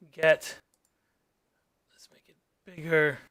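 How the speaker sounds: ordinary delivery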